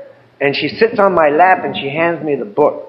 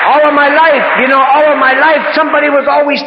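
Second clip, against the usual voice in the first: raised emphatic; 10 semitones higher, 4 dB louder than usual. Real, not acted. Emotional peak of testimony.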